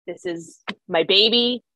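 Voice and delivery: ordinary talk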